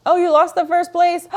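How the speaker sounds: loud, high surprised shout. Real, not acted. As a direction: burst out in shock at the news. Reacting to leaderboard change.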